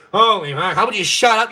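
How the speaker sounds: normal talking voice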